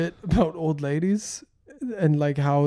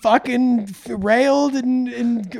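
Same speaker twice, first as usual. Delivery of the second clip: higher, louder put-on old-lady voice; 6 semitones higher, 5 dB louder than usual. Old lady boasting to comedian about her wild youth, mock voice. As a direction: act a brash old lady reminiscing, pitched up. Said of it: "old ladies who just come up to me and, like".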